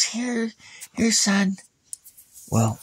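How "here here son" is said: put-on lightly higher old lady voice; a hint of a sweet old woman; old lady offering. Old lady handing Ivan cookies, lightly higher. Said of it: "She goes".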